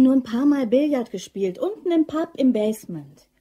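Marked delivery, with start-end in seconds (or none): none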